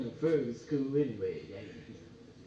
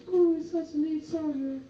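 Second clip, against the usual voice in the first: put-on high, admiring girlish gush; swooning girls; 10 semitones higher, 4 dB louder than usual. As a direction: gush over the soldier. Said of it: "The girls would be all over me".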